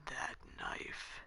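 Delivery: slow, low unvoiced whisper; whispering beast fixated on knife; creepy whisper. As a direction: breathe it slow and menacing.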